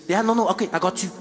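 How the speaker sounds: put-on lightly accented nervous purser; hint of a South African purser; flight attendant. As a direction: fuss over the celebrity. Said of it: "And then I said".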